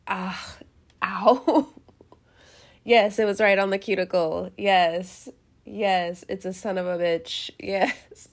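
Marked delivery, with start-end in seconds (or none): none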